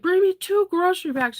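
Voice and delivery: high bossy order; high bossy call. Retold or imitated. Telling Hope before her shower, high bossy. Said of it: "I'm like".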